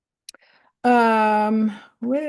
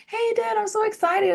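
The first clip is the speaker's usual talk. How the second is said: much higher, gushing with excitement; excited kid; 12 semitones higher than usual. Retold or imitated. A daughter phoning her dad, mock excited and much higher. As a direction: squeal it like a thrilled child calling Dad. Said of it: "I would call him and be like".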